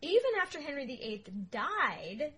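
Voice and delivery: ordinary talk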